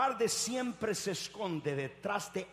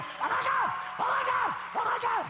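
very high, loud shriek; hammed-up helpless wailing; mock panicked scream; 6 dB louder than usual. Preacher mimicking those who stay in the boat, panicked screaming. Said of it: "No te quedes diciendo".

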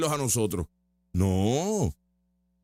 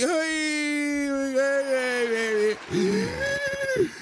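very high whiny ay cries; mock crying protester; 10 semitones higher, much slower than usual. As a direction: wail like a crybaby. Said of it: "Tú le alzas la voz y". (lamento chillón burlón)

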